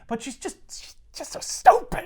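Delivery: high, crying-ish mock whine; mock whiny teen. Moping Peter complaining about Mary Jane, mock crying whine. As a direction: sob it out like a sulky teenager.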